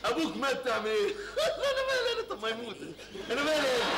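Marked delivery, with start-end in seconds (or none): 1.4-2.3 bursts out laughing, then shrugs. Acted. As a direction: cackle at the bad news. Laughs at news of death. (ضحك مستهتر)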